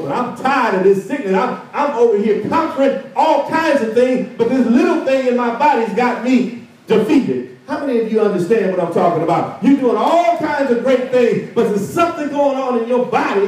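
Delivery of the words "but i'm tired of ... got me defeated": frustrated general. Acted. General complaining about his sickness.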